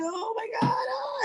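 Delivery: very high, soft crying whine; mock wail. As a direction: sob it out as if utterly overwhelmed. Acts overwhelmed babysitting sister.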